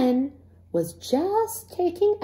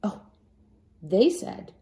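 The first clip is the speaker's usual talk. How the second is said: plain reading voice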